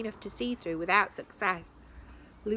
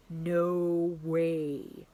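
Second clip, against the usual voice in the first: amazed; 2 semitones lower than usual. A narrator's reading. Sasha amazed.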